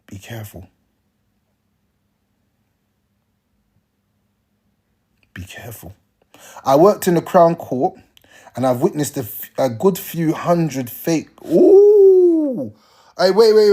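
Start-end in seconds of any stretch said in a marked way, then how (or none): 0.0-6.0 whispered warning. Real, not acted. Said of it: "warning repeated, drops to whisper". 11.5-12.7 high loud ooh of surprise; sudden delighted ooh; surprised exclamation. Real, not acted.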